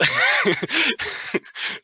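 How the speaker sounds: sudden high rising laugh; high laugh rising at the end. Real, not acted. Laughing at the trick.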